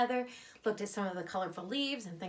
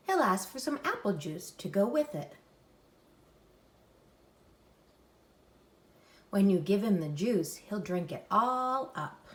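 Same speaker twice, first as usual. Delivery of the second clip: lively louder storybook read; reading to children; 5 dB louder than usual. Acted. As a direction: read it aloud for little kids. Reads picture book aloud.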